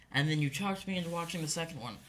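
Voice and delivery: normal talking voice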